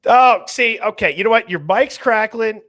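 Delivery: loud, high, fed-up outburst; exasperated shout. Real, not acted. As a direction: blurt it out at full volume. Host exasperated by the bad mic.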